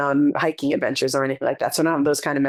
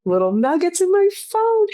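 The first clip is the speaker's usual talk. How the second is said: high, bright squeal of delight; light up, pitch jumps up; 14 semitones higher, 3 dB louder than usual. Real, not acted. Echoing her friend's phrase, high delighted squeal.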